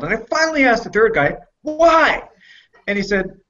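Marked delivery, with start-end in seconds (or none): 1.6-2.3 1 exasperated why. Retold or imitated. Asks third guy why.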